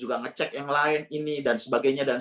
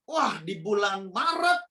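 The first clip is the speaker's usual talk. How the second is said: ordinary talk, nothing marked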